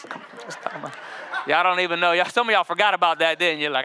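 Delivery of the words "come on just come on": hushed, breathy whisper; mock whisper. Pastor teases the man heading home, in a hushed whisper. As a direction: whisper it like a secret invitation.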